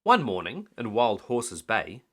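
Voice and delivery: usual speaking voice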